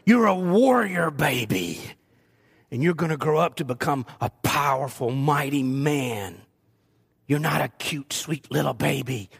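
playfully deep voice for grandson; low warrior voice. Retold or imitated. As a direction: growl it low to make him a warrior. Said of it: "I said".

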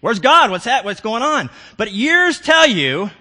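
loud, high, driving sermon climax; preaching at full force. Acted. Preacher urging his congregation to seek God, full-voiced. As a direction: preach it with rising fervor.

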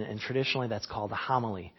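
ordinary delivery

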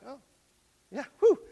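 relieved. Retold or imitated. Relieved reply to wife.

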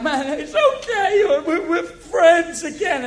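high, slow, gushy make-up voice; laughing mock sweetness between friends; mock forgiving. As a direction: ham up a sugary reconciliation. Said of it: "that you're like".

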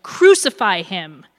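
loud, slow, falling cry; crowd yell. A narrator's reading. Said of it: "they yelled".

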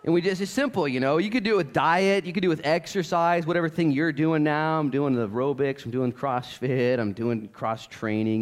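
ordinary delivery

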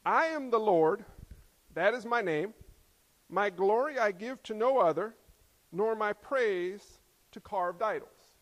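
loud, deliberate reading of God's words; forceful pulpit reading; scripture proclamation. Acted. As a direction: proclaim each clause and pause. Reads God's words from Isaiah.

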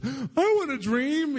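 very high, stretched-out mimicked plea; slow, squealed impression of eagerness; retold excited friend. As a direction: beg eagerly in an imitated high voice. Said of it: "freaking out".

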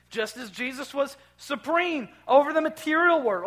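fervent sermon crescendo; preaching at full force. Acted. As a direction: ring it out over the congregation. Preaching climax on new creation.

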